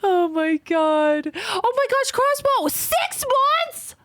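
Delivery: high, loud, thrilled gasping; thrilled high squeal over the six-month sub; excited squeal. Real, not acted. Thanking a long-time subscriber.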